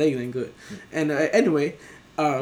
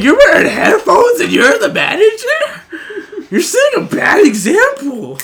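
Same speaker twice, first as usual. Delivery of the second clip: high, loud outraged telling-off; hammed-up indignation with laughter in it; mock scolding; 12 dB louder than usual. Customer berating the manager over headphones, mock scolding.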